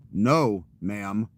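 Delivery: commanding, projected refusal; firm loud refusal. Acted. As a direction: project it firmly, like turning someone away. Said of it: "I guess when I'm telling you that".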